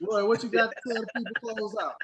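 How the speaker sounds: loud, fast hype call; excited. Real, not acted. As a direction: fire up the sign-off. Hyped host calling on friend.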